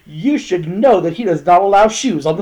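high, loud mock scolding; mock-offended crew member; indignant scolding. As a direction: tell him off for the shoes. Roommates scold Joel on couch.

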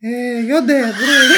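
high, mock-earnest classmate voice; light impression of a school friend; imitating the classmate. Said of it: "jag måste göra hans röst då".

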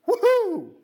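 a high, loud whoop of celebration; party cheer. Acted. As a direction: cheer like the whole house is partying. Party celebrating the brother's return.

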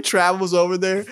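ordinary talk

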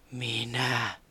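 soft, hissing ghost voice; hissing reply. A narrator's reading. Hidden ghost answering Taputi, soft and hissing. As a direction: breathe it out like an unseen spirit. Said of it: "kuului suhiseva vastaus".

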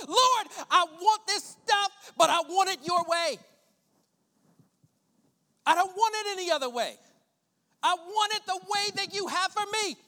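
loud, preached prayer; impassioned plea to God; fervent prayer. Acted. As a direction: cry out to the Lord from the pulpit.

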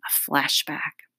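whispery, slow reveal; hushed aside. Real, not acted. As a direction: let it drop to a slow whisper. Reader announces a flashback, spookily hushed.

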